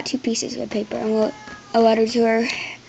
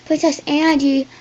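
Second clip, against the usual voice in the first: loud call across the yard; a messenger hailing the princess; 4 semitones higher, 6 dB louder than usual. Acted. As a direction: call her from horseback. Man calling out from horse.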